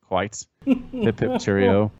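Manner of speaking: hammed-up British toff; a jokey upper-crust British cheer; playful fake British accent; mock British. Hamming up British phrases.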